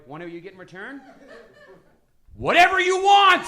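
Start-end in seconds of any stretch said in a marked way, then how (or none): 2.4-3.5 shouted high and loud with full force; big shout. Acted. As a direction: yell it out as the grand prize. Payoff shouted to the crowd.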